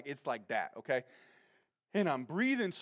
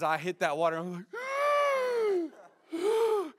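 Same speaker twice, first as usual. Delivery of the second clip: shocked gasp; 14 semitones higher, slower than usual. Retold or imitated. Shocked by cold water, gasp.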